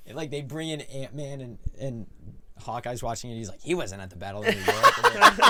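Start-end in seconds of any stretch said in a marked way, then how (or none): 3.6-5.5 loud, high, laughing quote; mock Hawkeye line. Hawkeye quoted mocking Ant-Man.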